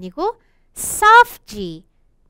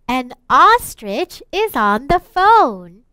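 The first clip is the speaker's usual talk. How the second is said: very slow, clear model reading; slow reading aloud; much slower than usual. Shown as a demonstration. Teacher reading slowly to children, clear and slow. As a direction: read each word slowly for the class. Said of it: "let's read".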